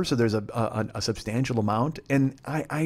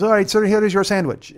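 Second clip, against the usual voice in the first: high, loud counter-worker voice; sandwich clerk; 9 semitones higher, 7 dB louder than usual. Retold or imitated. Counter guy handing a customer his sandwich, high and loud. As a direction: pipe up like a clerk calling out an order.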